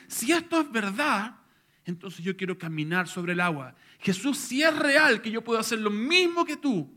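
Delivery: bold pleading. Acted. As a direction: push it up with eager faith, pitch and volume climbing. Peter boldly begs to walk.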